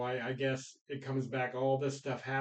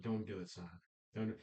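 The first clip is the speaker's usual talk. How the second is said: hushed plea; 5 semitones lower, 8 dB quieter, faster than usual. Retold or imitated. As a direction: lower and much softer, nearly whispered. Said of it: "and he whispers".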